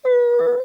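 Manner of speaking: high squealing brake noise; imitated screech. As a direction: screech like a bike stopping short. Imitates his bike screeching to stop.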